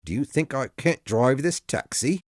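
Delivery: put-on old driver, slightly offended; taxi driver. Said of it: "the driver asked".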